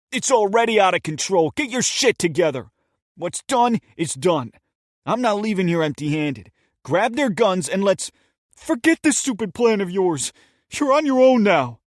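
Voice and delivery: loud, high, raging commands; a furious escalating shout. Acted. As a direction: yell it right in his face. Steven yanks partner, furious.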